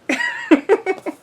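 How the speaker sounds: high, loud laugh breaking out; real laugh at the sweet memory; burst of laughter. Real, not acted. Laughing after telling mom story.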